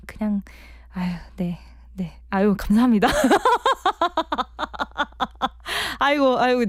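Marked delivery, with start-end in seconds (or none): 3.1-5.9 높게 터져 나오는 쑥스러운 웃음; 칭찬에 못 참고 웃음이 새어 나오듯. Real, not acted. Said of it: "제가 웃음을 숨기려고 했는데요". (청취자 칭찬을 읽다가 참던 웃음이 터지며, 높은 웃음)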